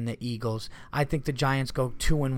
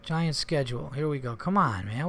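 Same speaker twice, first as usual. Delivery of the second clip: ordinary talk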